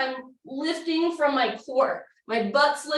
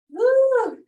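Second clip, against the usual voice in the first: high loud whoop of effort; effortful whoop; 10 semitones higher, 7 dB louder than usual. Real, not acted. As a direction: whoop while straining. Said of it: "it's hard".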